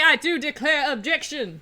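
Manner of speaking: loud, grand Southern gentleman declaring; aristocratic Southerner. Pretend. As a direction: proclaim it like a genteel Southern aristocrat. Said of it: "I am going to be aristocratic Southerner".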